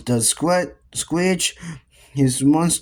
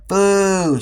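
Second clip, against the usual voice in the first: higher-pitched, playful jeer; lightly raised heckling call; mild shouted boo; 5 semitones higher, 3 dB louder than usual. A narrator's reading. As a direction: boo it out high. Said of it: "shouted Henry".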